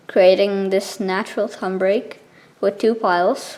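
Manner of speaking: ordinary delivery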